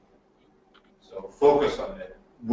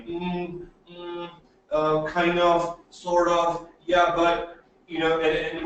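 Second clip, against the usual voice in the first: hesitant, higher, halting fillers; nervous filler words; 5 semitones higher, slower than usual. Shown as a demonstration. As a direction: stumble like a jittery speaker. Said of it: "you're going".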